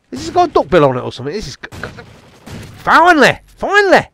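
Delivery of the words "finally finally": high-pitched cry of relief; exasperated relief. Real, not acted. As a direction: cry it out after too many failed tries. Relieved at getting a kill.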